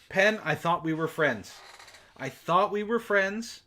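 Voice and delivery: ordinary delivery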